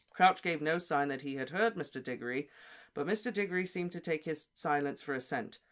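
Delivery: plain reading voice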